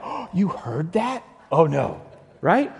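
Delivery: hammed-up gasp of dread at being caught; mock terrified. Preacher imagining himself as Thomas, mock terrified. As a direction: play caught-out alarm, drawn out. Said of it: "I would have been terrified".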